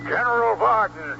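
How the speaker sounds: high-pitched commanding summons; imperious call. Acted. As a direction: call out sharply to an underling. Emperor summons general over screen.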